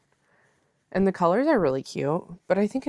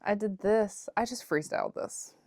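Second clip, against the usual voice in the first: ordinary talk, nothing marked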